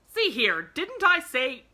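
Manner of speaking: loud, indignant outburst, rising in pitch; angry voice. A narrator's reading. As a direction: demand it angrily at full volume. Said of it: "began an angry voice".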